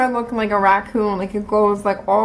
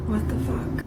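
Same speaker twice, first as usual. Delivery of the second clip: unbelieving whisper under the breath; hushed retold reaction; whispered disbelief; 10 dB quieter than usual. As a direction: whisper it, appalled. Stunned aside at email request.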